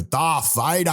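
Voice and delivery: a light, lifted call of the name; a passing fan naming the costume; imitating a passerby. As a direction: toss off the name like a passing stranger. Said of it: "I saw a guy go".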